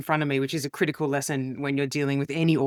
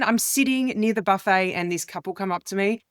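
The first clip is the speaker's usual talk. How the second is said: ordinary talk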